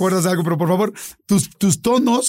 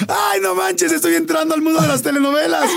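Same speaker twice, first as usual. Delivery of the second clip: telenovela drama; 10 semitones higher than usual. Retold or imitated. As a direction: overact it like a telenovela lead catching himself. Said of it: "lo dije en voz alta". (exclamación dramática de telenovela, aguda y en voz alta)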